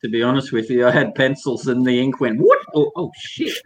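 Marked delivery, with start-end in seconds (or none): 2.4-3.5 sudden high yelp of dismay, half laughing; retold alarmed surprise. Artist retelling his ink mishap to friends, in mock alarm. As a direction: replay the moment the ink spilled.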